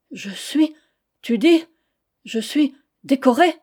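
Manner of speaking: high, halting, amazed question; husband stunned to hear he is decorated; stunned disbelief. Acted. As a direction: ask it as if you can't believe it.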